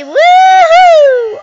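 high loud thrilled whoop; excited whoop. Real, not acted. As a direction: let out a joyful scream. Driving off a ramp.

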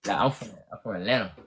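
low, quick Atlanta accent. Shown as a demonstration. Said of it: "do your A T L accent".

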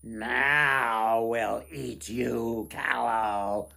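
loud, very slow wailing goblin cry; goblins yelling. Acted. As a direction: goblins howling at their prey. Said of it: "they yelled".

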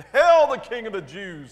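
loud mock salute; taunting false homage. A narrator's reading. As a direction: call it out as a jeering hail. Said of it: "they mocked".

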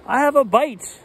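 high, eager call of a fish bite; Papa Bear calling out excitedly. Acted. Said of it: "called Papa".

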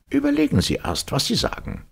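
very high professor, reprimanding; stern. A narrator's reading. Professor warning the captain to think first, stern. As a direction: scold him in a high character voice. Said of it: "entgegnete der Professor streng".

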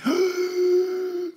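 sharp high gasp of fright; mock horrified gasp. Host asking listeners about bugs, acted fright gasp. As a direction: gasp as if a bug just crawled up. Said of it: "what creepy crawly gives you the".

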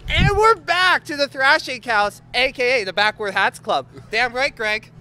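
high, loud back-from-break voice; show host announcement. Pretend. Host welcomes viewers back from break, loud announcer delivery. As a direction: hype the return to the show.

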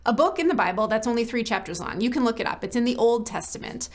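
normal talking voice

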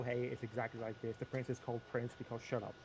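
normal talking voice

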